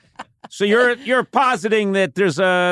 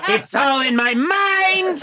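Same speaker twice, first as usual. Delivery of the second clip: grandiose mock; 9 semitones higher, much slower than usual. Self-parody, slow.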